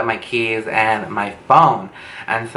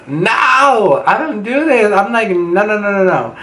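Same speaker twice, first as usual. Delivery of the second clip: very high wailed "no"; horrified refusal; 9 semitones higher than usual. Retold or imitated. Teller refusing her date's offer, high-pitched mock horror. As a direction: squeal a hammed-up string of refusals.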